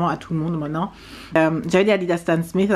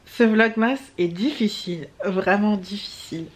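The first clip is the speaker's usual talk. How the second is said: ordinary talk, nothing marked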